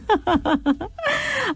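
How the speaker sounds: high, breathy burst of laughter; real laugh spilling out. Real, not acted. Host laughing at her own joke.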